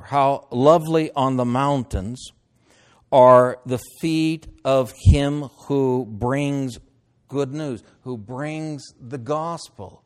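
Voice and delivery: slow, emphatic scripture proclamation; stretched-out, ringing Bible reading. Acted. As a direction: proclaim it with long, weighty words and pauses. Reads scripture aloud.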